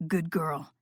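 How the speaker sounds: low, soft, approving; low soft praise. Acted. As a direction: praise her quietly and low.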